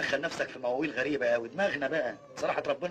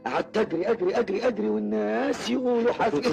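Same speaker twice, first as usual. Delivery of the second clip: high, loud, climbing run of words; excited chase retelling; 8 semitones higher, 5 dB louder than usual. Acted. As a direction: race upward as if still fleeing. Acting out a chase in his anecdote. (حكي بحماس وصوت بيعلى)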